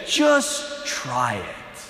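slow, soft, low invitation; gentle and calm. Retold or imitated. Alexander gently inviting conquered people, slow soft voice. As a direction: coax them gently, slowly. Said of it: "very gently, very calmly".

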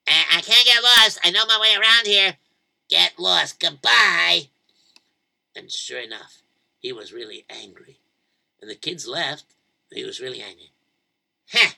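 usual speaking voice

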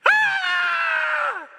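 loud, high-pitched scream; demonstrative scream. Shown as a demonstration. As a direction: let out a startling full-volume shriek of fear. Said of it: "that's what that word means".

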